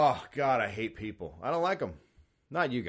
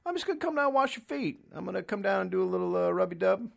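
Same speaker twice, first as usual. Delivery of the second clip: put-on higher, fast creepy old man; mock creepy old man; 6 semitones higher, faster than usual. Comedian playing an old foot-washer, higher and fast. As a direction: rattle it off like a cheerful creep.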